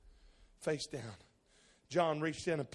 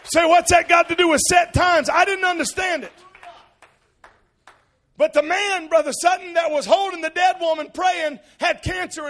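loud, high, shouted sermon climax; full-force preaching; 14 semitones higher, 22 dB louder than usual. Acted. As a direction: preach it with everything you have.

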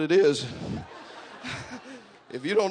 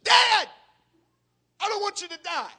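pleading shout. Retold or imitated. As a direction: cry out to a dying father up close. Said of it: "I got in his face and I said".

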